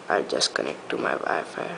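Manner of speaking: muttered low under the breath; muttering. Real, not acted. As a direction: say it to yourself, half voiced. Muttering to himself during setup.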